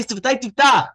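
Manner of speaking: "Sefatai Tiftach" said loud and high as its own phrase, then a stop; pause after it. Shown as a demonstration. Rabbi teaching the class to pause in prayer, marking the phrase off. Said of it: "Again, pause".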